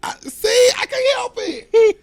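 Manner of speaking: high, loud, breathy complaint; breath blasting the mic; blowing in the mic. Real, not acted. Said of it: "You're blowing in the mic".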